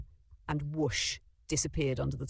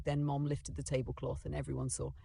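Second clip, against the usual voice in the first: plain reading voice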